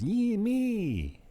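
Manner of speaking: put-on high sing-song Dominican-accented call; Dominican accent mimicry. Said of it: "Can't remember how he sings it".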